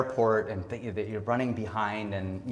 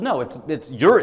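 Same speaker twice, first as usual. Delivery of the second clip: usual speaking voice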